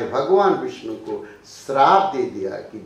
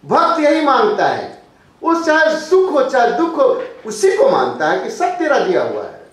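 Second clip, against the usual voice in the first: high, loud sermon climax; fervent preaching; 11 semitones higher, 7 dB louder than usual. Real, not acted. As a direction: proclaim it to the crowd. Preaching at full force. (ऊँची आवाज़ में जोशीला प्रवचन)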